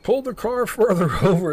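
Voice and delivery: high voice ordering, breaking into a laugh; hammed-up trooper order; mock cop order. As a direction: bark it like a goofy trooper. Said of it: "And they're like".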